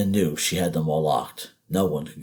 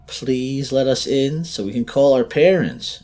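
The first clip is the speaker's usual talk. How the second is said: put-on pleading boy; 8 semitones higher, 6 dB louder than usual. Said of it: "it was the boy again".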